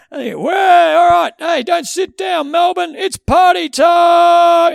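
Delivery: very loud, high, slow party-time call; hype-man shout. Retold or imitated. Mocking the rev up guy at the game, big MC shout. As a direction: stretch it out like an arena MC.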